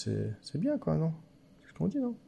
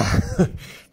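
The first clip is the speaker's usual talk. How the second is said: short burst of laughter; 4 dB louder than usual. Real, not acted. Player laughs at falling for the card trick, amused laugh. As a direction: laugh it off after being caught out.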